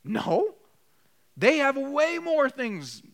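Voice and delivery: mock indignant complaint; defensive complainer. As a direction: whine it out defensively. Voiced defensive reply.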